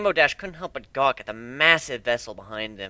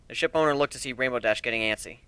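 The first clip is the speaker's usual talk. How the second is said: ordinary delivery, nothing marked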